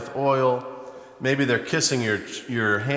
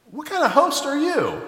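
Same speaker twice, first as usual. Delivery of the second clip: pitched way up, outraged at the host; indignant high rebuke; 14 semitones higher, 3 dB louder than usual. Acted. Jesus confronting Simon the host, voice shooting up high. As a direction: fling the question up high in disbelief.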